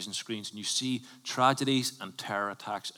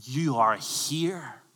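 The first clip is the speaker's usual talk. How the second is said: slow emphasis; 3 semitones higher, slower than usual. Real, not acted. Preacher stressing assurance.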